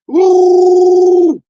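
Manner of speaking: high, loud whoop; triumphant woo. Real, not acted. Celebrating the spell he just cast, loud and high. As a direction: let out a big victory whoop.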